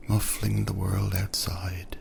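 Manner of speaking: usual speaking voice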